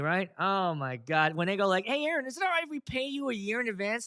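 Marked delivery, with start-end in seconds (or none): 1.9-4.1 mocking client voice. Clients asking to pay early.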